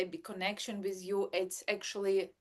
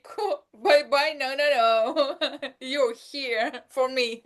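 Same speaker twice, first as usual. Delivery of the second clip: much louder, higher and slower, mock baby protest; imagined baby; 5 semitones higher, 11 dB louder, slower than usual. Mom imagining her baby protesting her leaving, mock clingy baby voice. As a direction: play the clingy baby refusing to let mom go. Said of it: "baby will say".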